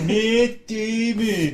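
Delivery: dopey voice. Pretend. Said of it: "repeats the silly voice".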